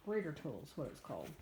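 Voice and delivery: ordinary delivery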